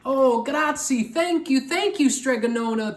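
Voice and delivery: high, loud relieved thanks; grateful cry. A narrator's reading. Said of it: "the people cried".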